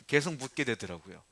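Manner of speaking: ordinary talk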